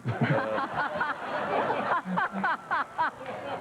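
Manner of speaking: real laugh that keeps rolling; burst of laughter. Real, not acted. Host reacts to the guest's blunt answer, sustained laughter. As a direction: crack up at a deadpan answer.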